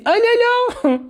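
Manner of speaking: sharp high yelp; high squeal of dismay. Retold or imitated. Diner biting anchovies again, squealing in dismay. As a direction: yelp at the salty bite.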